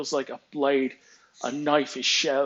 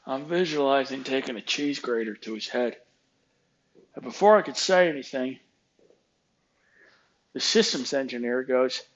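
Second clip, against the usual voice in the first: usual speaking voice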